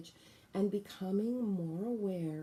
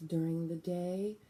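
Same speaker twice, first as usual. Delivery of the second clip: normal talking voice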